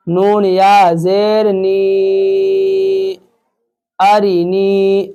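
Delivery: نی held long while spelling; slow recitation, نی lengthened; stretched madda. Shown as a demonstration. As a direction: draw out the ی of نی to one alif length. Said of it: "نی کو میں اس لیے کھینچا".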